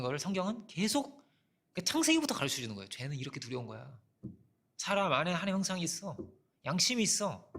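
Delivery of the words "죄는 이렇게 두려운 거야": quieter, lower warning; soft warning. Shown as a demonstration. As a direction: say it hushed, like a secret warning. Preacher voicing scripture's warning. (조용하고 낮게 경고하듯)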